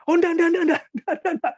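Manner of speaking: high, loud, rapid-fire comic refusal; acted panicked refusal. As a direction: replay hastily fending off an unwanted offer. Acts out hasty panicked refusal.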